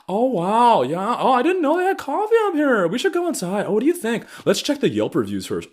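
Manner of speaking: high-pitched gawking tourist impression; mock-delighted high voice, easing down at the end; mocking gushy couple. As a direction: coo like an easily impressed out-of-towner. Mimics couple gawking at window.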